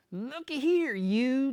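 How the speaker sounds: indignant bald man scolding. A narrator's reading. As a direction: snap at him like an angry old man. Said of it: "the bald man scolded".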